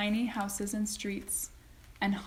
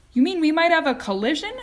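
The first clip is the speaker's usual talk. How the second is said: higher, loud frightened question; alarmed; 8 semitones higher, 10 dB louder, faster than usual. A narrator's reading. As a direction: panic at the thought of a crash. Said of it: "cried Mister T V".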